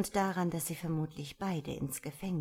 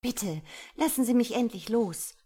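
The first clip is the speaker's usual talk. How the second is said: loud, high, pleading demand; insistent demand; 6 semitones higher, 8 dB louder than usual. A narrator's reading. Woman demands the man let her go, insistent. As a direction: demand release, pitched up and forceful. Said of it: "forderte sie".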